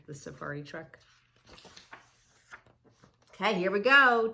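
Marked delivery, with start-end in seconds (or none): 3.3-4.3 much louder, eager kickoff; excited. Real, not acted. Excited lead-in.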